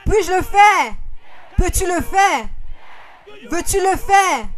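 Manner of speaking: retelling the pastor's shocked reply, loud; incredulous shout. Retold or imitated. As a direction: throw the question back in astonishment. Retold pastor's incredulous outburst.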